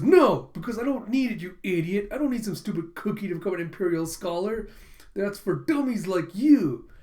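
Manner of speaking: loud, high opening burst; acted-out haughty tirade, leaning on the insult; scornful refusal. Boy rejects the girl's offered cookie, scornful.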